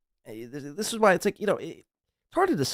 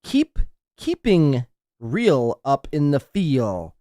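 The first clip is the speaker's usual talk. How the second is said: read-out quote; 4 dB louder, much slower than usual. Retold or imitated. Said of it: "Jake for five bucks says".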